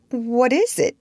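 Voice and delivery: high, mildly fearful question; shrinking cry. A narrator's reading. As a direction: ask it shrinking back. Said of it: "he cried, shrinking".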